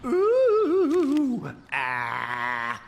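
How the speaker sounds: high, loud spooky wail, then dropping; spooky ghost moan. Acted. Villagers trying to spook Hans with a ghostly moan. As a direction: try to frighten with a ghostly ooh. Townsfolk try to scare Hans.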